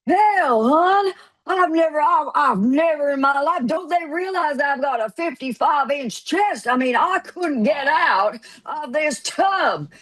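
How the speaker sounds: high, drawn-out indignant protest; mock indignation. Man freed from the tub rants, indignant. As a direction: imitate an affronted man protesting. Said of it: "that look of indignation".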